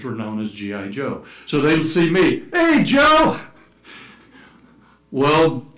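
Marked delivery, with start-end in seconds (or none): 2.5-3.4 high, loud imitated street holler; excited pointing-and-yelling impression. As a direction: call out to a passing foreigner. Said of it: "they point and yell out".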